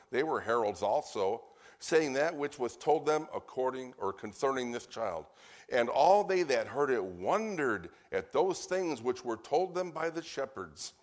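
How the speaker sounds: emphatic, projected Bible reading; proclaimed scripture reading. Real, not acted. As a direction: ring out the verses, stretching key words.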